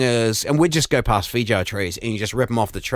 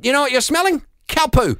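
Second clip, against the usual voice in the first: teasing; 13 semitones higher, 3 dB louder than usual. Retold or imitated. Teasing daughter about farm smell.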